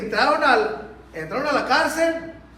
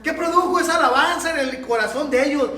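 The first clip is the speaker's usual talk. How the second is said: usual speaking voice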